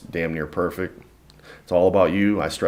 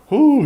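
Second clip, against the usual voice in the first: loud high whoo, catching breath; sharp whoo exhale; 11 dB louder than usual. Retold or imitated. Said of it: "I'm like".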